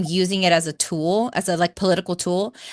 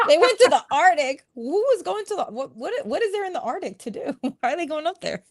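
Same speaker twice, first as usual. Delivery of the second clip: much higher, laughing questions; laughing disbelief; 10 semitones higher than usual. Real, not acted. She laughs about the Arctic cruise, high and amused. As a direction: giggle through the disbelief.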